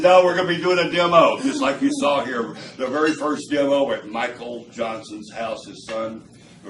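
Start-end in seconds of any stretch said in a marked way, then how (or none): 0.0-1.4 loud, high-pitched retort; insistent retold exclamation. Friend insists to Bill on doing a demo, loud and insistent. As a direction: burst in louder and higher, quoting him.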